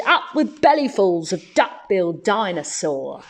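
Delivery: ordinary delivery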